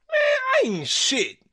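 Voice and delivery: very high, cartoonish squeal of self-mockery; mocking retort. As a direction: toss it back with a laughing sneer. Narrator answers Irish guy.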